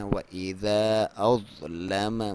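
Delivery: slow drawn-out tajweed chant; Quran recitation. Shown as a demonstration. Teacher recites the verse for students, slow chant. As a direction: recite it slowly with full tajweed. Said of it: "نہیں پڑھنا چاہتے تو جائز ہے".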